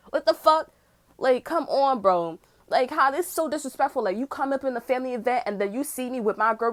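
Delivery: ordinary talk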